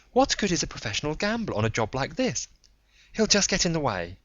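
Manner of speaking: Joan's knowing voice. Acted. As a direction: voice her like she saw it coming. Joan objects.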